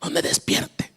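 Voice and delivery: urgent hushed whisper; imitating a mother's whispered scolding; mother's hushing whisper. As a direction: shush the kids without waking him. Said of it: "y mi mamá".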